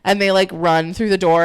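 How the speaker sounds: ordinary talk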